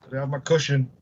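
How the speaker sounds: usual speaking voice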